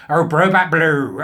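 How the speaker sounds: a hint of a camp ad voice, drawn out; Kenneth Williams. Quoting the old toilet-cleaner advert, slow and lightly camp. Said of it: "advertised by Kenneth Williams".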